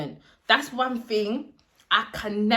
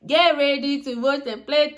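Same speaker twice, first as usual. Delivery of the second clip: mock gloating; 5 semitones higher, 5 dB louder than usual. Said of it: "And inside my heart".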